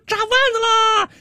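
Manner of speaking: comic market-stall call; street hawker's cry. Acted. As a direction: hawk your snack in a high voice. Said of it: "我每次一开摊儿吆喝吧".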